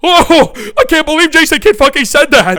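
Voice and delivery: loud, high, hammed-up fake shock; mock the fans' outrage, loud and high. Mocking fans outraged at Kidd.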